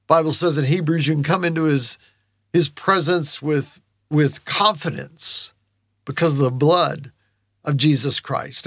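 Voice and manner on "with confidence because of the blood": normal talking voice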